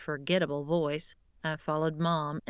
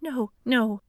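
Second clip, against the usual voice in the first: plain reading voice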